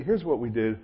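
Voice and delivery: normal talking voice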